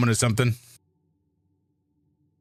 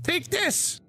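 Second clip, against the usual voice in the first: squeaky battle cry; 4 dB quieter than usual. Acted. Ant strikes back.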